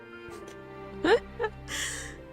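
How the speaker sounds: high, soft giggle; amused laugh. Real, not acted. Streamer amused by her own theory, giggling. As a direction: giggle to yourself.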